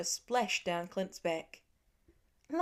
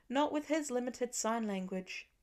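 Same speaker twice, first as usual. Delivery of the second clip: ordinary delivery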